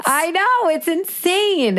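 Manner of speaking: high, laughing disbelief, slow on insane. Real, not acted. Laughing in disbelief to her co-host about hundreds of sick days. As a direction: laugh it off, amazed.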